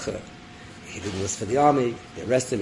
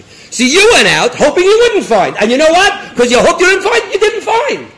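loud, high accusation climbing higher; impassioned rebuke; 13 dB louder than usual. Acted. Avram rebuking his servant for not finding guests, impassioned rebuke. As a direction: drive the charge home at full voice.

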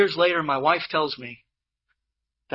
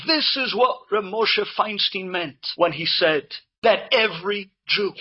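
normal talking voice

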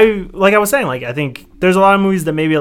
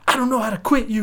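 higher, hammed-up reading of the famous line; dramatic movie line; 6 semitones higher, 4 dB quieter than usual. Host repeating the Brokeback line, hammed up. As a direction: ham up the cowboy's heartbreak. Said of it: "that one line from that movie".

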